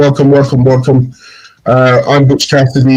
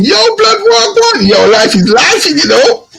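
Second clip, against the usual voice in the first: much higher, hyped bloke at the door; 14 semitones higher than usual. Retold or imitated. Imitating a man walking in and greeting everyone, much higher. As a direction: greet the room like a mate. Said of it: "the next man walks in".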